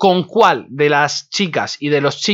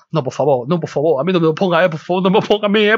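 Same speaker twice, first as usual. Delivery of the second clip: desperate pleading; 2 semitones higher, faster than usual. Retold or imitated. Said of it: "como diciendo".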